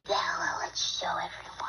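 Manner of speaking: soft unvoiced whisper; whispering to the dog. Real, not acted. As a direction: hush so as not to wake her. Hushed aside near the dog.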